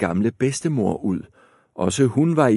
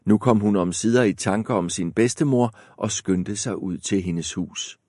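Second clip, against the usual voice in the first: plain reading voice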